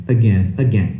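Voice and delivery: AGAIN low, as "uh-GEN"; weak "uh" opening AGAIN; reduced first A in AGAIN; correct pronunciation. Shown as a demonstration. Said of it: "realmente melhora a sua pronúncia".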